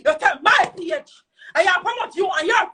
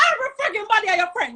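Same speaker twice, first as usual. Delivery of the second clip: angry accusation; 6 semitones higher, much faster than usual. Real, not acted. Angry accusation at the page owner.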